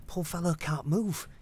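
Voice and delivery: ordinary talk, nothing marked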